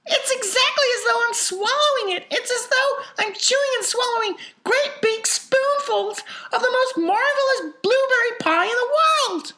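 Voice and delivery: very high, loud rapture; ecstatic girl. A narrator's reading. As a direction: gush loudly at the top of the range. Violet ecstatic over the pie.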